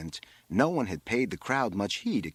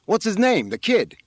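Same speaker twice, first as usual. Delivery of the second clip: high, loud brusque question; fireman calling out; 11 semitones higher, 9 dB louder than usual. A narrator's reading. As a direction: shout the question across. Fireman calls up to rabbi.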